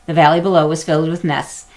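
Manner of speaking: ordinary delivery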